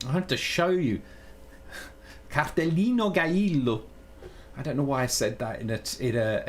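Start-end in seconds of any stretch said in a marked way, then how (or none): 2.3-3.8 loud-ish, lilting mock Mexican accent. Streamer announcing a card colour in a put-on Mexican accent. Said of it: "in a Mexican accent".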